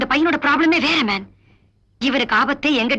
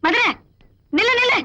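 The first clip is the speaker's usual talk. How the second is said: calling out stop; 9 semitones higher than usual. Acted.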